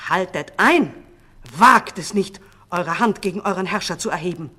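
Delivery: loud warning, quickening at the end; commanding call. A narrator's reading. True king stopping his own guards, calling out. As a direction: halt them with a ringing command. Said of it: "rief dieser".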